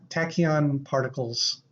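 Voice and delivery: usual speaking voice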